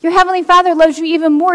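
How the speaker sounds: usual speaking voice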